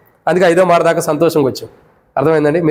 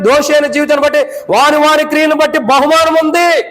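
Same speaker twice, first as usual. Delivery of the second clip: fervent preaching; 8 semitones higher than usual. Acted. Pastor warning the congregation about vain words, in fervent high preaching. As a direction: preach it hard, rising and driving.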